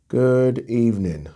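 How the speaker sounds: slowed down, falling at the end; slow drawn-out greeting. Shown as a demonstration. Host to viewers, a slow drawn-out greeting. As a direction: stretch out the Good evening.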